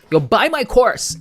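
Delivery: high, loud and slow, hamming up a course pitch; mock sales pitch. Joking to viewers, loud mock guru hype. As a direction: sell it like a fake guru. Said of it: "enough joking around".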